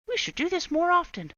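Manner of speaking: put-on high, loud happy children's voice; pleased kids. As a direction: say it like smug kids.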